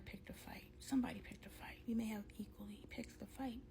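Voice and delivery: hushed secretive whisper; a conspiratorial whispered aside. Real, not acted. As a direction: whisper it so nobody at home hears. Whispering before husband gets home.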